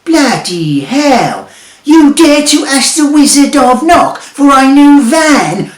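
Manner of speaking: high, loud, slow outraged roar; wizard shouting. A narrator's reading. Wizard outraged that Bob dares ask for a van, shouting. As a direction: blast Bob for his cheek. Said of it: "shouts the wizard".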